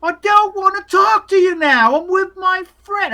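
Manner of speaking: put-on very high, loud child yell; drawn-out, high-pitched bratty shout; little boy yelling. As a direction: yell back at Mom like a stroppy kid. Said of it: "and he yells".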